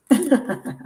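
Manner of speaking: short high laugh. Real, not acted. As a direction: let out a brief, high giggle. Own laugh at the card.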